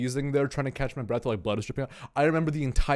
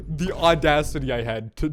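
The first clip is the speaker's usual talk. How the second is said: normal talking voice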